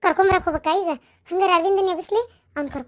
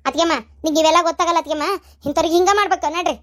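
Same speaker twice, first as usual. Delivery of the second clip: usual speaking voice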